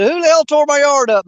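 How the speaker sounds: mild mock outrage about the yard; mock angry wife. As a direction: yell it like a fed-up wife. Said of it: "she come in the house".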